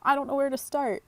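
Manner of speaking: playful high-pitched viewer voice; eager viewer. Pretend. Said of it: "you're like".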